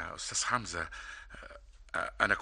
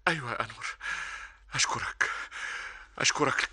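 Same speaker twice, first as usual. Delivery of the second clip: higher, rushing, emotional thanks; overjoyed; 6 semitones higher, faster than usual. Acted. Older brother pours out joyful thanks to Anwar, higher and rushing. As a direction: pour out the joy.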